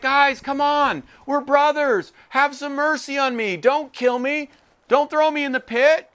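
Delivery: high, earnest pleading for mercy; pleading brother. Retold or imitated. Young Joseph begging his brothers from the pit, high and pleading. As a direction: beg them, a brother asking not to die. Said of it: "He was pleading with us".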